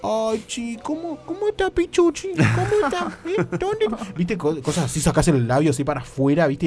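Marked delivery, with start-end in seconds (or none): 0.0-3.9 voz de pelotudo cachondo. Shown as a demonstration. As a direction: play the cooing fool trying to get intimate. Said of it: "hablas como pelotudo". (vocecita aguda y boba de seducción)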